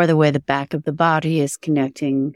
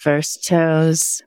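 guided meditation. Acted. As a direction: guide attention gently through the body.

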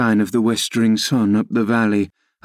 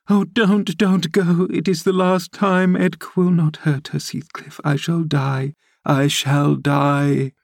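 shrieking, fading to wail; 7 semitones higher than usual. A narrator's reading. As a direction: plead frantically high, then stretch out the last despairing words. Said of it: "she shrieked".

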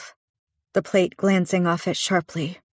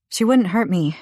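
quick; 3 semitones higher, 5 dB louder than usual. Acted. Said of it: "I said quickly".

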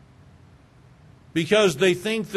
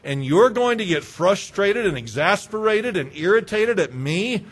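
louder, indignant preaching; indignant rebuke; 3 semitones higher, 3 dB louder than usual. Pretend. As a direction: scold them with righteous anger. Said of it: "what my flesh has wanted to say".

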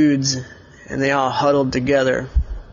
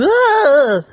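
high startled scream of fright; panicked yelp; 12 semitones higher, 5 dB louder than usual. Acted. Elliot yelping as a huge player rushes him, high scream. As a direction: yelp as the big player charges. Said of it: "and Elliot went".

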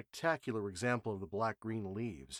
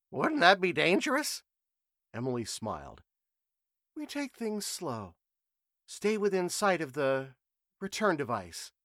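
higher, clear reasoning voice; sharp young reporter; 7 semitones higher, 6 dB louder than usual. A narrator's reading. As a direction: lay out the logic plainly. Emily voiced, measured.

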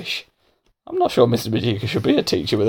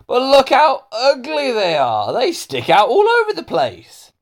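jeering schoolboy; 12 semitones higher, 3 dB louder than usual. Acted. Hamish mocks Melanie's teeth, jeering. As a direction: jeer in a much higher voice.

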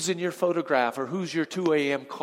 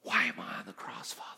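unvoiced whisper, much softer; Jesus whispering from the cross; whispered anguish of Jesus; 10 semitones lower, 8 dB quieter, faster than usual. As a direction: breathe it out as a dying man. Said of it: "if he had this thought".

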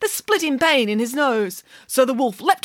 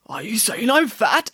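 wolf voice. Acted. Wolf hurt.

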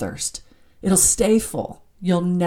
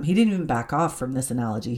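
normal talking voice

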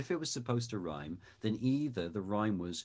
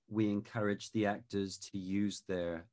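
usual speaking voice

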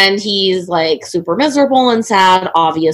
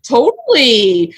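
high, bright, eager yes; eager naive Edmund; 3 semitones higher, slower than usual. Retold or imitated. Retelling the movie, she mocks Edmund's eager yes. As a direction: play him gullible and keen. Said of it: "Edmund's like".

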